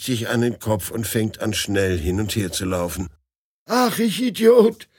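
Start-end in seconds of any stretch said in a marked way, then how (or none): 3.7-4.9 high, loud self-reproach; cursing himself. Acted. The groom curses himself for boarding the wrong train. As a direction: berate yourself in dismay. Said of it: "schimpft er".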